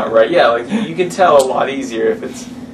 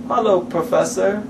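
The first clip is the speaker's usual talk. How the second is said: a light take on Patrick stiffly reading his line, slow and pitched up; imitating Patrick; 5 semitones higher, 3 dB quieter, slower than usual. Imitating a friend's line reading when asked for it. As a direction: read it like lines off the paper. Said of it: "Give us a line".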